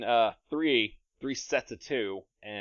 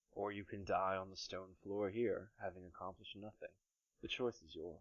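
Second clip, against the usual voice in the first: soft, hushed villain voice sinking away; whispering tempter; 3 semitones lower, 7 dB quieter than usual. Acted. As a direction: quietly tempt a dying monk with eternity. Smoke voice tempts the dying monk.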